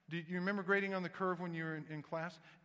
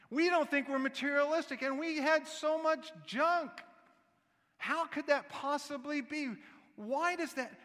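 higher, louder, exasperated incredulity; mock disbelief; 8 semitones higher, 5 dB louder than usual. Preacher recalls his family's garage sale, mock disbelief. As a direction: act out indignant bafflement at the pile.

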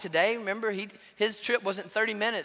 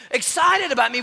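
normal talking voice

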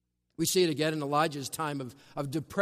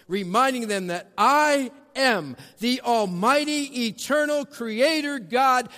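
fervent preaching; 8 semitones higher, 7 dB louder than usual. Acted. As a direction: proclaim I am with full force.

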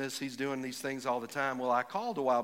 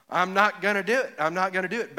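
loud, higher mock toddler refusal; defiant toddler; 5 semitones higher, 6 dB louder than usual. People telling God no, like a defiant toddler. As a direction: dig in like a stubborn toddler. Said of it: "Back to the toddler".

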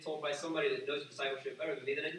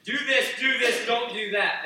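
higher, louder commands; bossy parent; 7 semitones higher, 10 dB louder than usual. Retold or imitated. Parent barking rules at their kids, raised and loud. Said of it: "Don't teach them a bunch of".

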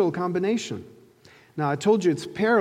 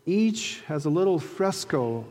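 usual speaking voice

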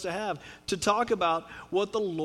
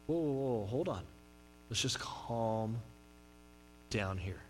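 calming someone down; 9 semitones lower, 9 dB quieter than usual. Acted. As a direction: talk a panicking person down, gently. Calming someone who is panicking.

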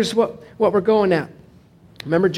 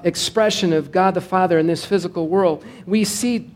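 normal talking voice

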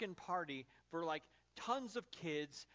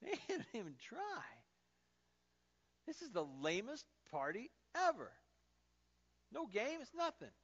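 higher, mock disappointed whine; bored, let-down guest; 6 semitones higher than usual. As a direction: grumble like a let-down party guest. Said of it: "you're thinking to yourself".

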